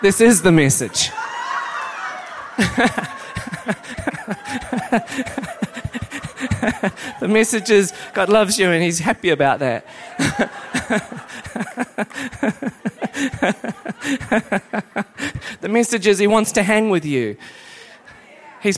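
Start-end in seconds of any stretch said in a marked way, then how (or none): 1.2-7.1 breathless giggle between words; long giggling laugh. Real, not acted. Preacher giggling to the church. 10.2-15.5 breathless giggle between words; long giggling laugh. Real, not acted. Preacher giggling to the church.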